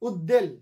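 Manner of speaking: উদ্বেল said as ud-del; mistaken doubled d. Shown as a demonstration. Teacher repeating the common mistake, ud-del. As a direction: turn the ব of উদ্বেল into a d. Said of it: "কত যে ভুল উচ্চারণ".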